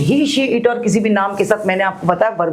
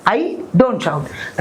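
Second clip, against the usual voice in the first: slow model sentence; much slower than usual. Shown as a demonstration. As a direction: drill it slowly for students. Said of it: "मैं नहीं चिल्लाती". (बहुत धीरे, शब्द-शब्द अलग)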